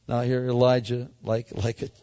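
usual speaking voice

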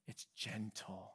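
slow, hushed whisper; a soft whispered demonstration of gentleness; gentle whisper. Shown as a demonstration. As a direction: breathe the word gentle softly. Preacher illustrating gentle wisdom.

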